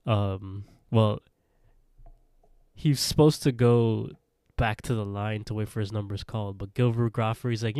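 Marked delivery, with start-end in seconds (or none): none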